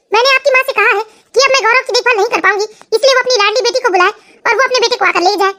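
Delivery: ordinary talk, nothing marked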